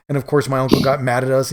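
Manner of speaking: ordinary delivery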